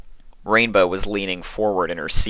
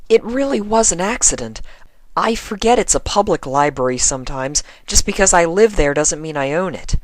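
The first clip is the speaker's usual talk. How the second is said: apologetic; 11 semitones higher, 4 dB louder than usual. Acted. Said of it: "Twilight sounded extremely apologetic".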